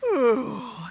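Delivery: big unvoiced yawn. A narrator's reading. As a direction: breathe out a sleepy yawn. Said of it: "he yawned".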